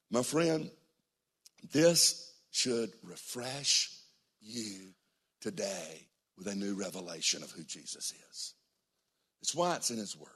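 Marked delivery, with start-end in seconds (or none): 1.6-8.5 soft and very slow; hushed pastoral comfort; slow tender appeal. Acted. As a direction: draw each word out gently.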